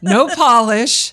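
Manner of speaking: loud and slow; emphatic. Real, not acted. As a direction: punch it out like a slogan.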